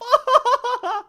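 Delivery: shrill delighted giggle after the brag; high giggle. Acted. Vampire debutante giggling at her own boast. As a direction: giggle like a spoiled noble.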